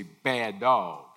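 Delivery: firm "Bad dog" to a dog in training; stern scolding. Shown as a demonstration. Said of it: "it's the emphasis".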